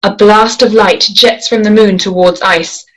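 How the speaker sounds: normal talking voice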